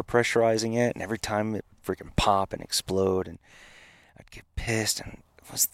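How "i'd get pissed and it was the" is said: hushed whisper of frustration. Real, not acted. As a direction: whisper it as if reliving the anger. Retelling frustration at failed prototype.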